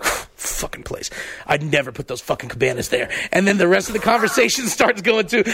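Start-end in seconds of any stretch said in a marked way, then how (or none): 0.0-3.1 under-breath grumble. Retold or imitated. Co-host imitates Mike cursing the cabanas under his breath. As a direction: mutter it to yourself in disgust.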